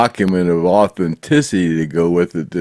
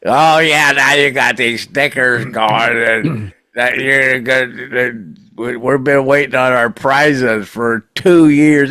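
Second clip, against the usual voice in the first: complaining fan; 5 semitones higher than usual. Retold or imitated. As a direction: imitate an impatient fan griping. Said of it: "I saw somebody go".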